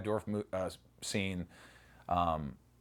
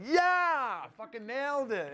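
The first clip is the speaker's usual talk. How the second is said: much higher exultant yelp; triumphant mock cheer; slower than usual. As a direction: crow about nailing it. Recalling his triumph at the take.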